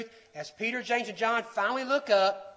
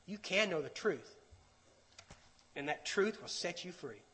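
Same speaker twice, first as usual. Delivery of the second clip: very soft, lowered assurance; voice of truth; 3 semitones lower, 11 dB quieter than usual. Acted. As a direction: promise it quietly, with a pause midway. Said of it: "the voice of the truth says".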